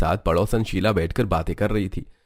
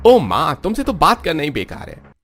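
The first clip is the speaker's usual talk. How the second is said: high, loud burst of frustration; acted irritation, lifted pitch; exasperated son; 7 semitones higher, 4 dB louder than usual. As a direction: give up on arguing with mother. Son gives up arguing with mother.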